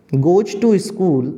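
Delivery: word by word with long pauses; slow dictation. Shown as a demonstration. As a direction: dictate each word for students to write. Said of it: "teacher dictating example slowly".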